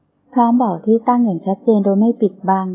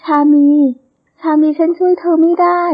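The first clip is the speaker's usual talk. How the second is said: anguished crying; 6 semitones higher, 3 dB louder than usual. A narrator's reading. Heroine cries to her murdered friend that she failed her, anguished. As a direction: wail it in helpless grief. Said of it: "เธอร้องไห้ราวกับหัวใจแตกสลาย".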